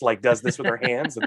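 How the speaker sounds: usual speaking voice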